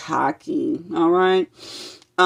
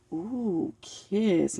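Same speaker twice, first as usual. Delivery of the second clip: ordinary delivery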